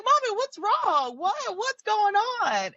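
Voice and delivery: put-on high, little-girl voice asking what's wrong; child's voice. Mom voices her five-year-old daughter.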